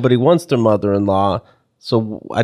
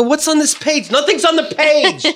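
mock baffled reader; 13 semitones higher than usual. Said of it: "I go".

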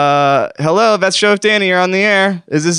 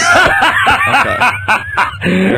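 laughing out loud; 10 semitones higher, 3 dB louder, slower than usual. Real, not acted. Host cracks up at joke.

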